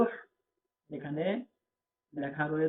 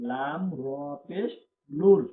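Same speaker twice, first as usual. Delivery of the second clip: ordinary talk, nothing marked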